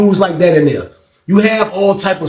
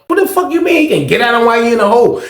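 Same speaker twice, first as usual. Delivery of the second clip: indignant outburst; 8 semitones higher, faster than usual. Real, not acted. Outraged question.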